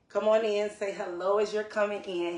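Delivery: excited loud invite to the stream; loud welcome. Real, not acted. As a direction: call them in with energy. Host calling in arriving viewers.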